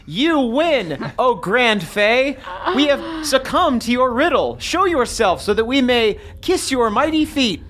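high-pitched, theatrical surrender proclamation; grandiose shout. Acted. Player surrendering to a Grand Fae over a riddle, high grandiose shout. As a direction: cry out grandly to a mighty fey, pitched well up. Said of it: "Okay, I shout".

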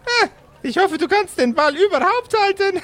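very high sneer ending in a laugh; mocking rival with cackle. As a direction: tease him and laugh at him. Rival taunts, then laughs. (hoher, spöttischer Rivale mit Lachen)

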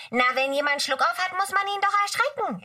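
high, bright little elf voice; Mücke's high voice. Acted. Mücke explains to Kimchi in a high elf voice. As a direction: chirp it up high like a tiny fairy.

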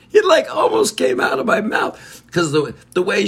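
cracking up mid-story; real laughing. Real, not acted. Host confessing what nearly slipped out, laughing.

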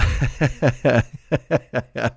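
drawn-out chuckling laugh; chuckle. Real, not acted. Podcaster admitting he has no friends, amused chuckle. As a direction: chuckle at your own joke. Laughing at his own joke.

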